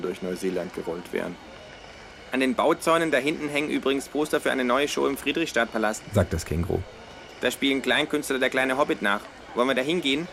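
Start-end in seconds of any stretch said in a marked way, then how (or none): 2.3-10.3 a touch higher, cheeky kangaroo; lightly voiced know-it-all kangaroo. Kangaroo pitching a show to its flatmate, lightly voiced and higher.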